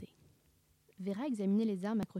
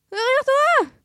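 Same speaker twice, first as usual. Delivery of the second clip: very high, loud squeal; panicked cartoon dog; 14 dB louder, much slower than usual. Scooby warns Sammy of what's behind, squealing.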